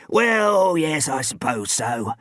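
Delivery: slow, drawn-out agreement; high start, easing down slowly; genial old man. A narrator's reading. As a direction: concede genially as an elderly man. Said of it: "he said genially".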